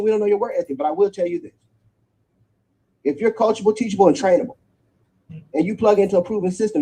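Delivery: ordinary delivery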